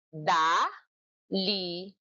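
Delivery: "Daliri" drawn out slowly; slow syllabified "Daliri"; slow syllables. Shown as a demonstration. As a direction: beat each syllable slowly. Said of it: "Ipalakpak nyo nga".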